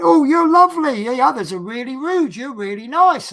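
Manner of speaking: high, loud, gushing praise; gushing passenger. Retold or imitated. Passenger praising the new driver, gushing high and loud. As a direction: pour it on like an overeager fan.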